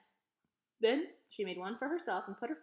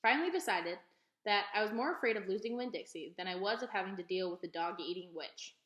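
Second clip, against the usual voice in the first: ordinary delivery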